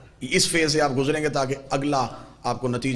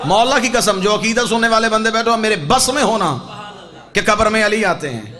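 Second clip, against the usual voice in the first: loud, raised sermon delivery; impassioned preaching; 5 semitones higher, 6 dB louder than usual. Real, not acted. Preacher swears to congregation he awaits death, loud impassioned preaching. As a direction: preach it out to the whole crowd.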